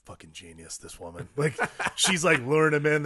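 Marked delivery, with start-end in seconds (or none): 0.0-1.3 awed murmur. Retold or imitated. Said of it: "And I'm like".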